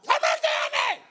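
desperate scream, high and loud. Acted. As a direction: cry out even harder for mercy. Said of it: "Like he's screaming".

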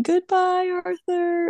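high, drawn-up goodbye; imitated farewell call. Buster waving as he drives away, high farewell call.

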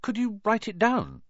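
put-on high, tentative boy's suggestion; Harry. A narrator's reading. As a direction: offer it helpfully in a boy's voice. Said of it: "Harry suggested".

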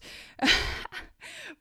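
a bright high laugh at herself. Real, not acted. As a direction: laugh it off, amused. Laughing at her own story.

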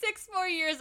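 high and loud through a laugh. Real, not acted. Friend laughing at the kid's groundhog mix-up. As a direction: repeat it cracking up. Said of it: "Oh my God, that is great".

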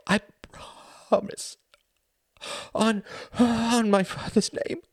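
high, gasping, halting oath; desperate mother. A narrator's reading. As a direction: swear it through breathless emotion.

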